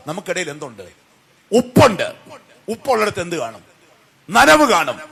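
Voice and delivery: ordinary talk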